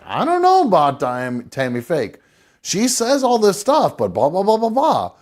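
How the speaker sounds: lightly voiced skeptical friend, rising on the blahs; a mild mocking take on a doubtful friend; imitating Mary Elizabeth. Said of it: "that's exactly how she'd be".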